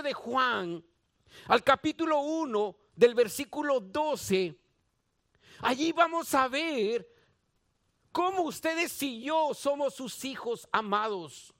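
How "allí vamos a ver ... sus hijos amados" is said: ordinary delivery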